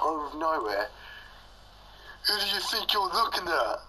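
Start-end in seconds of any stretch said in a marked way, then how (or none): 2.3-3.8 mock angry girl. Angry stranger on the train.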